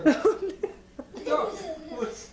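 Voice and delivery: high, slow laugh through the words. Real, not acted. Laughing as she protests to the doctor.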